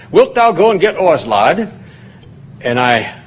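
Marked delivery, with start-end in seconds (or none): 0.1-1.7 light Yorkshire lilt, higher start on Wilt; a mild imitation of a Yorkshire farmer calling the lad; Yorkshire accent. Farmhand David asking the young student, light Yorkshire imitation. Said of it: "he said it heavier and faster than that".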